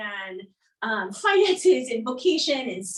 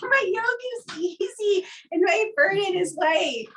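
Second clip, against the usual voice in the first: higher, bright-sounding mock Jesus line; lightly voiced Jesus; 9 semitones higher than usual. Guest quoting Jesus with amused disbelief, raised pitch. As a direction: toss off the verse in a raised playful voice.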